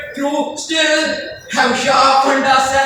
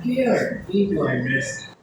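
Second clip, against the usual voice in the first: ordinary delivery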